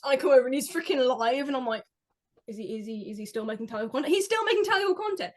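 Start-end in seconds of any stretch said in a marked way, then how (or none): 2.5-4.1 quick, low, quiet questioning; low incredulous question; hushed disbelief. Acted. Acted disbelief on finding the channel. 4.1-5.3 high-pitched amazed realisation; high, incredulous exclamation; astonished outburst. Acted. Astonished realisation, same words again.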